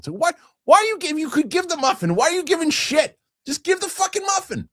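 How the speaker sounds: very high-pitched comic indignation; exasperated rant. Acted. Comedian railing at the guy withholding a muffin, exasperated. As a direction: plead in squeaky mock outrage.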